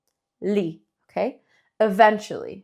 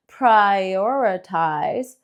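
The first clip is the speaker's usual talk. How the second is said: "prioritize" stretched very long, every sound held; very slow stretch; much slower than usual. Shown as a demonstration. Teacher exaggerating length for learners, very slow. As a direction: drag "prioritize" out as long as possible. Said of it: "Lo alargamos".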